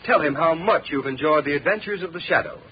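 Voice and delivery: normal talking voice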